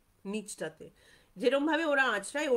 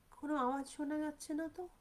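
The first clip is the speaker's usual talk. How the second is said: higher, softer, hushed as if the jungle went silent; a storyteller's soft, eerie pause; hushed suspense; 4 semitones higher, 6 dB quieter, faster than usual. Retold or imitated. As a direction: let the words drop to a suspenseful hush.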